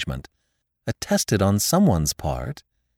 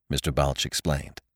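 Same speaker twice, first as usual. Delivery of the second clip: plain reading voice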